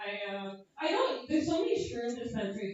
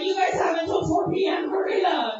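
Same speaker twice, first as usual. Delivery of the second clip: louder mock order, half-joking; mock yelling; 4 semitones higher, 10 dB louder than usual. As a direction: bark it like a coach hurrying the team. Yells at sperm in a mock scene.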